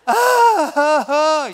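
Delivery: high, loud mock sobs in bursts; imitated sobbing moans. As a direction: sob it out like she's beside herself, played up. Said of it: "She's moaning and sobbing".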